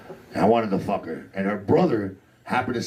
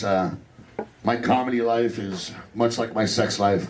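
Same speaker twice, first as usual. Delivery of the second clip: ordinary delivery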